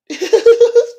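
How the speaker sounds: short high burst of laughter. Real, not acted. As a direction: laugh out at her own bit. Laughing at [name]'s character.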